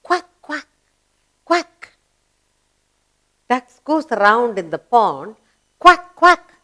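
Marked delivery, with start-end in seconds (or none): 0.0-1.9 high, short imitated quacks; duck's quack. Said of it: "Duck says". 5.8-6.5 high, loud imitated quacks; duck's quack. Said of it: "Duck says".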